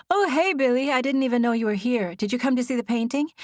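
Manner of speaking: breezy upbeat greeting; bright cheerful sister. A narrator's reading.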